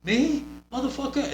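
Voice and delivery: high-pitched incredulous "who, me?"; mock indignation. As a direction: act the affronted heel answering the crowd. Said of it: "then you're like".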